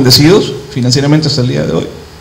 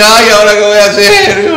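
high, hammed-up wail; mock crying; 6 semitones higher than usual. Preacher mimics himself helpless over debt, mock crying. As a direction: whine it like a pretend cry. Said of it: "No me puse a llorar".

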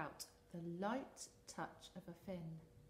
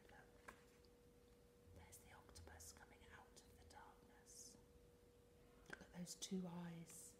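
whispering; 14 dB quieter than usual. Acted. Spooky whisper to children.

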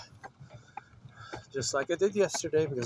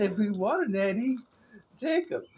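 imitating her; 6 semitones higher than usual. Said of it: "she said".

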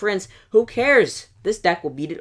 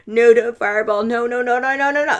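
rising, louder string of frantic no's; real gamer panic, higher and louder each time; panicked no's; 7 semitones higher, 7 dB louder than usual. Real, not acted. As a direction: plead with the screen, climbing higher. Panics as fireball comes in.

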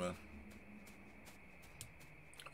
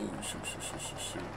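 breathy unvoiced panicked whisper; tense whisper; 7 semitones lower, 14 dB quieter than usual. Real, not acted. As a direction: hiss it under your breath. Hiding from enemies, tense.

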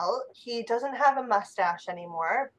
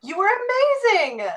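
high, swooping exclamation; gushing praise; 9 semitones higher than usual. Acted. Friend congratulates the musician after the gig, gushing. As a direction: gush with delight.

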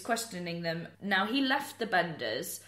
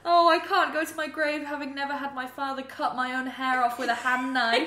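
higher, louder hammed-up wail of regret; laughing mock lament; 6 semitones higher, 6 dB louder than usual. Imagined runaway regrets her life, in a laughing mock lament. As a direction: lament it loudly, on the edge of laughter.

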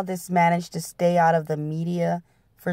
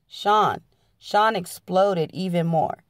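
plain reading voice

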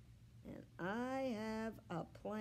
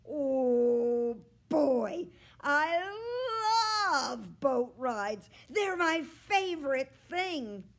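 much louder, higher, bursting with joy; thrilled; 6 semitones higher, 12 dB louder, slower than usual. A narrator's reading. Dog delighted about the boat ride, loud excited gush. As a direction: gush like an overjoyed puppy.

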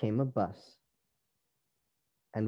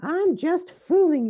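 high, loud playful cry; 7 dB louder than usual. A narrator's reading. Rosie teases her sisters, high loud cry. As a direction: exclaim it in a high little voice. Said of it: "cried Rosie".

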